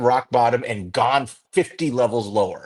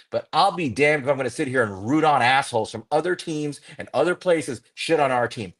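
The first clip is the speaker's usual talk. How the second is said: normal talking voice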